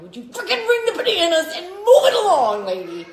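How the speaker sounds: high, loud, exasperated mock yell; impatient outburst. Shopper retelling her thoughts in a checkout line, loud impatience.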